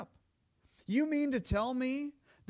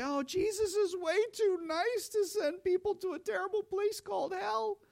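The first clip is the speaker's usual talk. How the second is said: high-pitched mocking voice of the doubters; mocking skeptic; 12 semitones higher than usual. Preacher mocking those who call Jesus too nice, high scoffing voice. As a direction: mimic them in a high, scoffing tone. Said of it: "who say".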